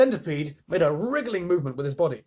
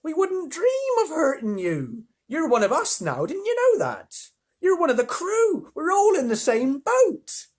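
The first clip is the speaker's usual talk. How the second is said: very high, slow, gentle ladybird; kindly ladybird; 14 semitones higher, 5 dB louder than usual. Acted. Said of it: "the ladybird said kindly".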